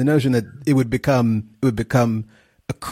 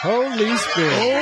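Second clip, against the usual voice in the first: church response; 8 semitones higher, much slower than usual. Pretend.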